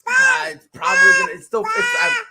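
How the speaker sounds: sudden high excited outburst. Real, not acted. Streamer reacting live to his player getting hit, high and loud. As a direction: blurt it out high and loud, alarmed.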